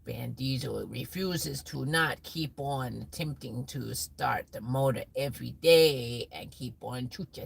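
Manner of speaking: normal talking voice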